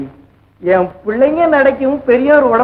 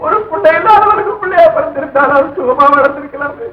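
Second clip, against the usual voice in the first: wailing lament; 3 semitones higher than usual. Acted. Parent laments over beaten children, tearful wailing. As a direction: cry out in anguish, then tail off.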